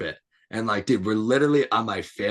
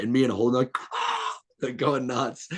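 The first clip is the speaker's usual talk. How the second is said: normal talking voice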